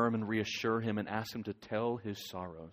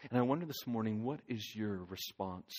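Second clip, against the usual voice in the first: ordinary talk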